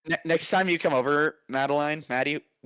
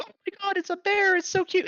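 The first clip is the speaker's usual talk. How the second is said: very high, squealed imitation; imitating a squealing onlooker; mock screaming. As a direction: put on a high-pitched squeal of delight. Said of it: "where they're, like, literally screaming. They're like".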